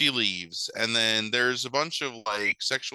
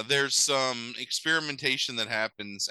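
usual speaking voice

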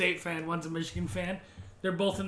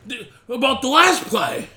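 outraged fan; 8 semitones higher, 10 dB louder than usual. Retold or imitated. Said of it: "Mark's like".

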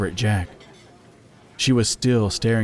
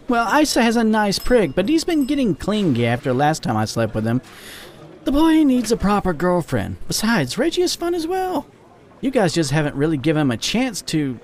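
high, lively female character voice; teasing female friend; 10 semitones higher than usual. Acted. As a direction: voice the chatty friend, pitched well up. Voices Jacqueline in dialogue.

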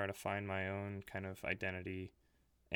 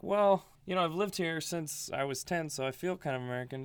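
higher, louder mock plea that fades back toward normal; German transplant's protest; 7 semitones higher, 5 dB louder than usual. German coworker protesting to a local that he feels American, high mock plea. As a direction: play the hurt German guy insisting he belongs, starting high and loud. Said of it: "It's like".

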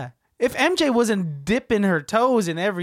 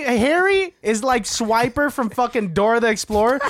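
normal talking voice

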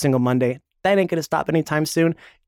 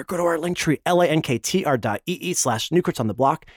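ordinary talk

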